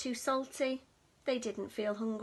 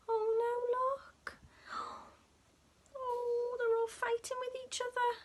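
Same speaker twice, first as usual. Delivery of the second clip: high gasping whisper, rushed at the end; alarmed whisper; 10 semitones higher than usual. Real, not acted. Narrator alarmed aside to child.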